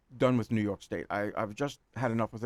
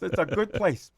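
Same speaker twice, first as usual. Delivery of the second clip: put-on high, louder New York accent; 7 semitones higher, 5 dB louder, slower than usual. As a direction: close it out like a New Yorker. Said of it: "in my New York accent".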